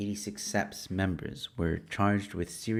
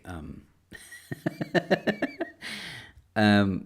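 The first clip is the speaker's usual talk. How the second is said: ordinary talk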